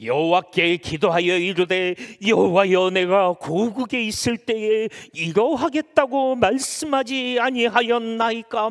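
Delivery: high, drawn-out period-drama plea; historical drama. Acted. Preacher reads Jonah's prayer to God, historical-drama declaiming. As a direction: declaim it slowly like a costume drama. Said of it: "이 무슨 사극 찍습니까?".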